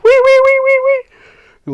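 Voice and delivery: high loud wailing alarm noise; imitated alarm siren. Kicking bathroom door sets off alarm, loud siren imitation. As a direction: wail like a blaring siren. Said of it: "an alarm goes off".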